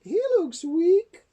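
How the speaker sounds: high, loud dismayed squawk; grumbling mother dinosaur. A narrator's reading. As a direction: complain loudly in a high character voice. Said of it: "And she grumbled".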